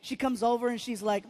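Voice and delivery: usual speaking voice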